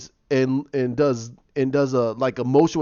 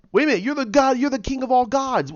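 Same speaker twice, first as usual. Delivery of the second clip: indignant disbelief; 9 semitones higher than usual. Retold or imitated. Daughter challenging Zeus.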